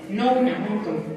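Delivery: quick, low-key and run together; dicho rápido. Real, not acted. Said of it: "lo dije rápido y no se entendió". (dicho rápido y de corrido)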